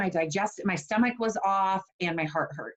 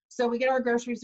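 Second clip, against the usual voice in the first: ordinary talk, nothing marked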